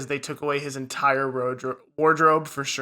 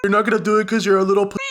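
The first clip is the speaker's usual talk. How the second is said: fast, slightly higher mocking taunt; mock older brother teasing; 4 semitones higher, faster than usual. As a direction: rattle it off like a sneering older brother.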